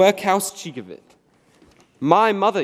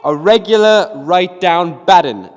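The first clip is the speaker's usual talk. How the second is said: defiant repeat; 2 semitones higher, 7 dB louder than usual. Acted. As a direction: spit it back at him. Noah repeats insult defiantly.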